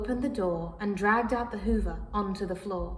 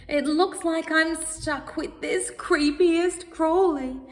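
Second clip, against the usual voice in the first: mild wail; 6 semitones higher, 4 dB louder than usual. Acted. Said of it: "wailed the cat feeling awfully poorly".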